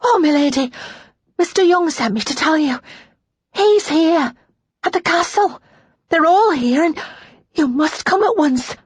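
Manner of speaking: high, urgent maid's voice; feverish, out of breath from running; breathless excitement. A narrator's reading. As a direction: gasp out the news in bursts. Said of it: "struggling to catch her breath".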